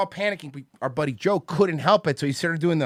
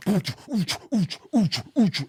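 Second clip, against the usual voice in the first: mock thrusting grunts; 6 semitones higher, much slower than usual. Imitating thrusting dancer.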